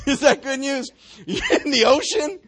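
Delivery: asked through a real laugh. Real, not acted. Preacher laughs at the verse with the congregation, laughing. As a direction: laugh as you ask it.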